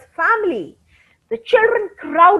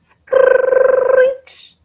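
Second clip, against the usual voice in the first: high shrill screech sound; mock screech; 10 semitones higher than usual. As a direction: screech like a sudden brake. Sound of brakes or gate.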